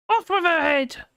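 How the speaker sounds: high, hammy royal decree; Off with her head shout. Retold or imitated. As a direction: bellow it like a mad queen. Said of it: "Carrie Antoinette".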